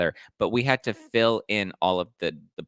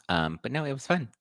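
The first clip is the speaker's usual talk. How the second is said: ordinary talk, nothing marked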